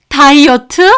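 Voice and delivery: loud, high scoffing rise on 다이어트; 다이어트 spat out rising and loud; mocking disbelief. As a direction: throw the word back in disbelief. Grandmother mocks diet excuse.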